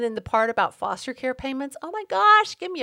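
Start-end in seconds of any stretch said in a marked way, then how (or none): 1.8-2.8 exasperated outburst. Real, not acted. Exasperated at false claims.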